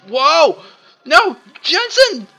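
very loud, high panicked yell; panicked scream. Real, not acted. Companion falls, panic.

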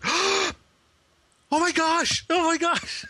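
put-on very high, squealed kid excitement; imitated excitement. Retelling a kid getting his own handbook, high excited squeal. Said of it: "he was, like, all excited".

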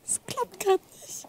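unvoiced whisper with a small laugh; embarrassed whisper. Real, not acted. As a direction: whisper it sheepishly as the demo breaks. Demo fails, embarrassed aside.

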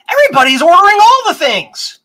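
high, loud thrilled exclamation; excited. Real, not acted. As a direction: burst out with delight. Excited by incoming orders.

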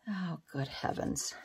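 soft, under-the-breath "Oh, good heavens"; quiet self-directed sigh of words; muttered to herself. Real, not acted.

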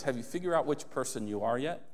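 ordinary talk

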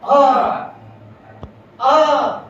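sharp loud cry of pain at each axe blow. Acted. Tree struck by the axe. (કુહાડીના ઘાથી દુખાવાની ચીસ)

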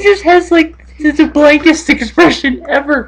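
high, squeaky voice from real laughing; tearful with laughter. Real, not acted. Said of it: "I'm, like, in tears because I'm laughing so hard".

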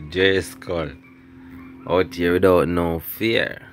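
ordinary delivery

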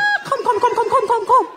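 high, eager come come come; excited calling. Real, not acted. Host urging a shy guest to the stage, high and excited.

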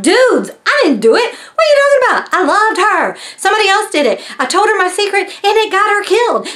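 high-pitched mock desperate protest; protesting innocence. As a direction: plead your case like a wrongly accused man, acted out. Acts accused man protesting innocence.

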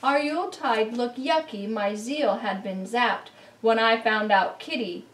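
plain reading voice

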